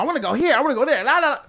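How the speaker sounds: mocking girlfriend imitation. Mimicking the nagging girlfriend.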